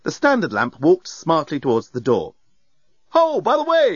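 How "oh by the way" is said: high, breezy bellbot voice; cheery, carefree service robot. A narrator's reading. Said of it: "it said breezily".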